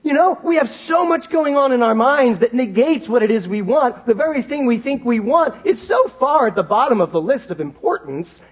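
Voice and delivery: loud, high, driving sermon voice; fired-up pulpit delivery; full-force preaching. Acted. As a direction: build it up and preach it out to the room.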